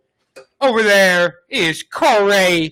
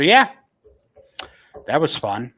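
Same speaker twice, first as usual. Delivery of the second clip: ordinary talk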